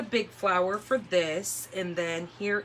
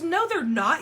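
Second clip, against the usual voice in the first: husband objecting; 8 semitones higher, 5 dB louder than usual. Retold or imitated. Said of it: "And so he goes".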